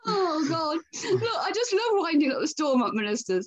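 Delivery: laughing as she talks about winding up ministers. Real, not acted. Podcast guest laughing about teasing Stormont ministers.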